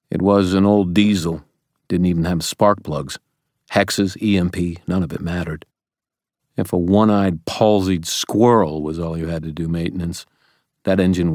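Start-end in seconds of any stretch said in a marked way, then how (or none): none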